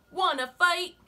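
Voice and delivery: high, loud, grumpy bug; grouchy ladybug voice. Acted. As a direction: bark the challenge up high. Ladybug challenges skunk.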